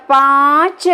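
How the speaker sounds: slow पाँच with a long, open nasal aa; drawn-out chandrabindu. Shown as a demonstration. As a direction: draw पाँच out with the mouth open. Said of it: "जब हम अनुनासिक का उच्चारण करते हैं". (धीरे खींचकर बोला पाँच)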